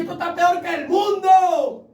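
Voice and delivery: a retold exasperated shout; exasperated outburst. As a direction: burst out at the church's failings.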